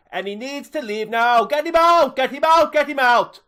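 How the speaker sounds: high, loud fan ranting and chanting; furious fan rant. Acted. Terry raging to the reporter about the manager, furious shouting. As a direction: scream your fury at the manager. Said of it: "Terry, Terry, just calm down".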